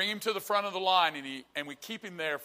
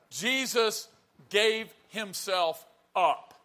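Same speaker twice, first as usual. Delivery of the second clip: slow, weighty preacher's declamation; emphatic preaching; 3 semitones higher, much slower than usual. Real, not acted. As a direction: draw out each word with deliberate pauses.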